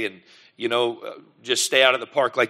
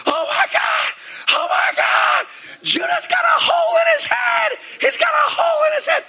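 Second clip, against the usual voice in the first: put-on high shrieking panicked cry of a little girl; panicked crying daughter. Daughter runs in crying to her dad, high panicked shriek. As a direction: wail it like a terrified child. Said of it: "she's crying. And says".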